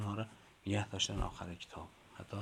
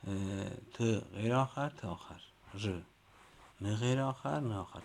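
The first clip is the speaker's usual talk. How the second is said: louder rising chant of each letter form; classroom recital; 2 semitones higher, 4 dB louder than usual. Shown as a demonstration. Teacher drilling the letter chart with the class. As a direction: call out the list like a drill. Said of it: "ببینید آخر کتاب نوشته".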